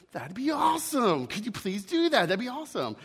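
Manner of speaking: high, eager acted delight; entusiasmo actuado. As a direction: play the ghost thrilled by the offer. Ghost welcomes the angel's offer.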